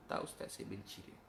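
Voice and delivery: put-on near-whispered, the boy muttering it again; a quiet, sullen echo; hushed boy's answer. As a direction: let it drop to a breath. Boy's answer repeated, softly.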